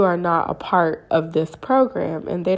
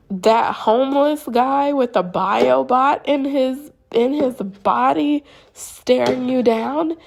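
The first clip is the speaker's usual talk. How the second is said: slow, high, insistent acted question; incredulous; 6 semitones higher, slower than usual. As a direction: spell it out in disbelief. Pressing partner who denies it.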